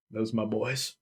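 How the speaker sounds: low, understated grief; grim father. Acted. Father sees the corpse-sized bundles, subdued low voice. Father faces his children's bodies.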